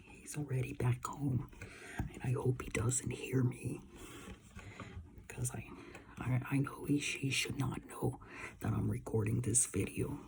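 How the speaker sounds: hushed whisper, hiding from dad. Real, not acted. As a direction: keep it to a secret whisper. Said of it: "I'm gonna lower my voice".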